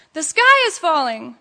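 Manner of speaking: storybook duck's warning; quacked alarm. A narrator's reading. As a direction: call it out like a panicked duck. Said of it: "Ducky Lucky quacked".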